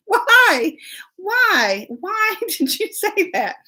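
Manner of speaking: high, drawn-out mock dismay; appalled pleading. Mom asking her child about the embarrassing confession, high and appalled. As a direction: wail it in comic exasperation.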